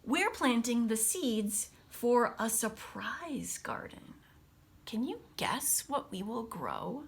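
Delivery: lively storybook read-aloud voice; picture-book reading with big pauses; reading to children. Acted. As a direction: read it brightly to a class of kids. Teacher reads picture book aloud.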